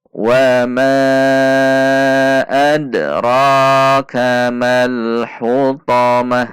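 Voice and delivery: slow, measured recitation with held long vowels; Quran recitation demo. Shown as a demonstration. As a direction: recite evenly, drawing out each long syllable. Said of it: "Mari kita baca".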